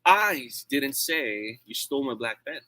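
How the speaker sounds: emphatic "I", as if someone else said it; stress on I. Shown as a demonstration. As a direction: lean hard and slowly on the word "I". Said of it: "if I say it like this".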